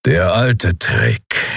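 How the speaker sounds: slow, grudging growl; low growl. Acted. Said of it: "knurrte Keru mit widerwilliger Anerkennung".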